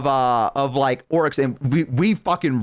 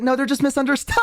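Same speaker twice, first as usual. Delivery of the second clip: high-pitched imitation of the elf; defending elf; 11 semitones higher, faster than usual. Elf defending orcs to other elves, protesting. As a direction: plead their case in a raised voice. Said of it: "And she's like".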